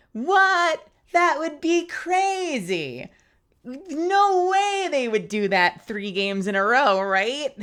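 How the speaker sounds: loud, high mock shock, then slow and drawn out; sarcastic shocked streamer; sarcastic disbelief. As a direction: feign outrage that they'd ever do that. Mock disbelief at chat's framing joke.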